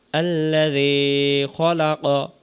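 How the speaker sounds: slow, drawn-out tajweed chant; Quran recitation. Shown as a demonstration. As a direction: recite it like a qari, very slowly. Recites verse. (ধীর তেলাওয়াতের সুরে)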